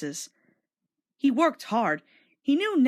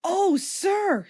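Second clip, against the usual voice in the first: higher, delighted; light up with joy; happy exclaim; 9 semitones higher than usual. A narrator's reading. Thomas thanking the director, happily and higher. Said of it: "said Thomas happily".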